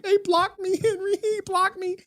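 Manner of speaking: very high, whimpering mock complaint; high whiny mock sob to Henry about being blocked; mock crying whine. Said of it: "He could go and cry like".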